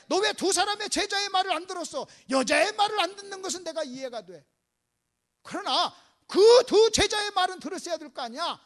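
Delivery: staged telling-off; acted rebuke. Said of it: "이렇게도 책망할 수 있어요".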